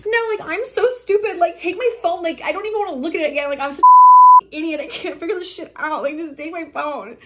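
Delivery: high-pitched, distraught self-blame; imitated tearful friend. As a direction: imitate her upset, voice pushed high. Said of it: "crying and shaking".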